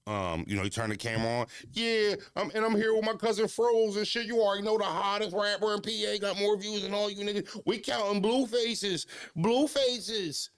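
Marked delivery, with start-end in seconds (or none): 1.7-10.5 high, hyped-up flexing voice; mock rapper boast. Mocking a cousin's boastful camera intro, high and hyped. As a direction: ham it up like a bragging rapper on camera.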